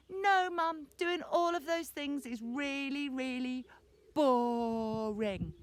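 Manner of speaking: put-on grumpy little boy refusing everything; grumpy whine. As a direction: whinge at mum, stretching out the last word. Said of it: "He was just grumpy".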